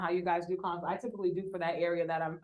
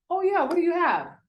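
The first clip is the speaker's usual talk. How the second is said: a high, louder burst of interest; eager investor reply; 9 semitones higher, 4 dB louder than usual. Retold or imitated. Investor answering her cold message, high and eager. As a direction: play the investor suddenly keen on the deal.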